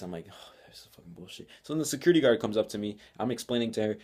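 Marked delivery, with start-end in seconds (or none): 0.3-1.5 whispered annoyance under the breath; muttered grumble. Retold or imitated. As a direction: mutter it almost inaudibly to yourself. Retold grumble to himself.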